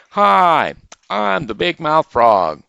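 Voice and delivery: high, loud, booming big-mouth frog; high, loud frog shouting hello to the rabbit. Acted. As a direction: shout the greeting like a proud frog. Big Mouth Frog introduces himself.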